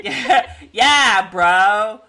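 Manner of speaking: loud laughing surfer bro; loud bro-style agreement with a laugh; surfer dude. Retold or imitated. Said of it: "surfer dude".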